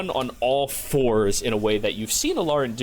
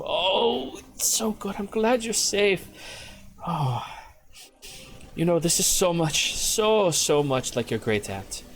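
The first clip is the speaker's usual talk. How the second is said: high, gushing, doting mother voice; 8 semitones higher than usual. Acted. GM voicing Prissa's mother hugging her lost daughter, high doting voice. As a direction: coo over the lost child with relief. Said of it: "mother turns around and goes".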